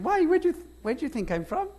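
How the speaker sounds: put-on high-pitched questioning Valleys accent; attempted Valleys accent. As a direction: ask it in a put-on Welsh Valleys lilt. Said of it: "stay in accent".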